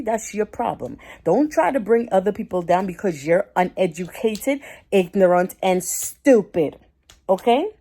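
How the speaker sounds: loud, fed-up scolding; angry lecture at an ignorant stranger, loud; angry telling-off. Pretend. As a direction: tear into him with loud indignation. Imagined telling-off of the man.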